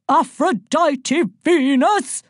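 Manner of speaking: loud, high, slow official summons; thunderous roll-call, each syllable stretched; booming. A narrator's reading. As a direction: boom the name across a reception. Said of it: "a voice boomed across the reception".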